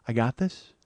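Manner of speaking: low, soft, deliberate; cool reassurance. Retold or imitated. [name] answering tour outrage, quiet calm assurance. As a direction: play her unbothered, almost murmuring. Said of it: "she's gonna go".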